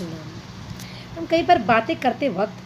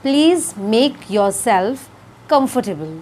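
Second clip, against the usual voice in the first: very slow teaching demo; much slower than usual. Shown as a demonstration. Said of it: "तो English में हमें इसे कहना है".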